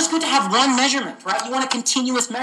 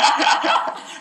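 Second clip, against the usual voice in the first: high burst of laughing; real laughter; 6 semitones higher than usual. Real, not acted. Teacher amused by his own absurd question, laughing. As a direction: break up laughing at your own joke.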